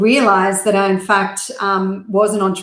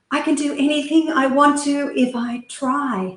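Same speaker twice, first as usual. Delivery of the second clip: P=A; small child reciting; 6 semitones higher than usual. Retold or imitated. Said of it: "And so I said to him".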